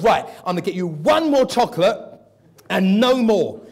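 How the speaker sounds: loud, firm giving-in; mock stern mum. Mother to child in Tesco, loud and firm. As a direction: lay down the last offer firmly. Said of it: "She goes".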